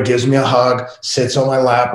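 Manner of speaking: ordinary talk, nothing marked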